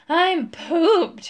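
loud, high, puffed-out complaint; panting. A narrator's reading. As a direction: gasp it out, exhausted from flying. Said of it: "he panted".

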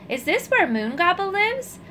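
small voice. A narrator's reading. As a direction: sound small and far below. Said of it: "a little voice asked".

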